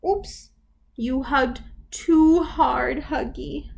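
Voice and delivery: storybook voice, lively for little ones; reading to children. Acted. Teacher reads the picture book to toddlers. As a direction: read it like circle time.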